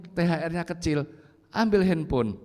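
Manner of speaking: ordinary talk, nothing marked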